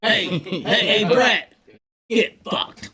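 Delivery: loud taunt. Real, not acted. Taunting friend Brett loudly.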